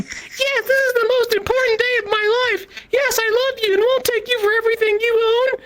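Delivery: mock bride's vows. Mocking the bride at the altar in a high, gushing falsetto.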